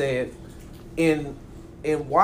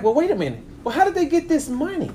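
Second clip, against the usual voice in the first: incredulous; 10 semitones higher than usual. Retold or imitated. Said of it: "And it's like".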